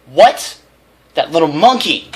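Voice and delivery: high, loud outburst; mother appalled at the bully; outraged surprise. A narrator's reading. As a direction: burst out in shock.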